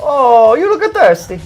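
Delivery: put-on Italian accent. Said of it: "How would she say it in your Italian accent?".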